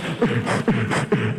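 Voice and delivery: low thumping mouth-made beat; vocal dance-track thump; imitated club music.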